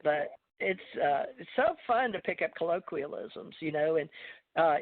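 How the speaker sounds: ordinary talk, nothing marked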